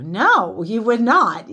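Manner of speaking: loud, high stress on not; No, you would NOT, punched hard; emphatic no. Real, not acted. Telling viewers they would not, emphatic. Insisting the answer is no.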